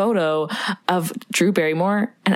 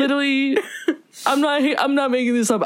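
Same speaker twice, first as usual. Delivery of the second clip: breaking up with laughter mid-sentence; laughing; 6 semitones higher than usual. Real, not acted. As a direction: giggle through the denial. Laughing while retelling her story.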